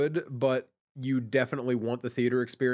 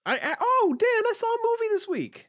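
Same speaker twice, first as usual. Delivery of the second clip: much higher, gushing; excited; 3 dB louder than usual. Real, not acted. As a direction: burst out with excitement. Excited movie announcement.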